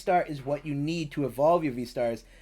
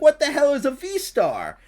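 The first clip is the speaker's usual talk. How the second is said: higher and louder, outraged disbelief; indignant outburst; 11 semitones higher, 10 dB louder than usual. Retold or imitated. Retelling opening a card pack, mock outraged shout. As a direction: blurt it like you just got ripped off. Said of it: "I'm like".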